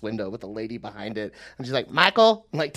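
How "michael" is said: calling a name. Retold or imitated. Receptionist calls him to window.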